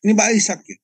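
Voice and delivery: high, loud eager caller's voice; supplier's driver on the phone. Retold or imitated. Driver phones the pastor with news, high and eager. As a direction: pitch it up like a keen driver ringing. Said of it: "itu supirnya supplier".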